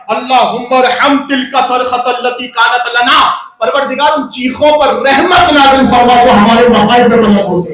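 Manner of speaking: loud, impassioned sermon climax; full-force preaching. Acted. Preacher to the mourners on chest-beating, shouting. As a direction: preach it out with rising fervor, shouting.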